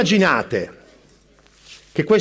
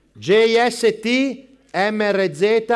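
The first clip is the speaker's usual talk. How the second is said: slow spelling; much slower than usual. Acted. Spelling a code letter by letter.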